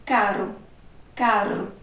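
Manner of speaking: "caro" with a brief tongue-trembling R; short, weak R. Shown as a demonstration. Teacher showing the single R between vowels, "caro" short and tapped. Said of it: "A língua da gente até treme".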